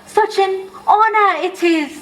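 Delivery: lightly voiced high house elf, slow; a touch of eager squeakiness; high-pitched elf voice. A narrator's reading. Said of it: "in a high-pitched voice".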